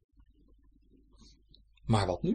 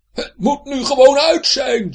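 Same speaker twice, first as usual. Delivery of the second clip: loud, slow, high angry yell; shouting; 13 semitones higher, 15 dB louder than usual. A narrator's reading. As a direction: yell it out, fed up. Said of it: "schreeuwde hij daarom". (langzaam en luid schreeuwend)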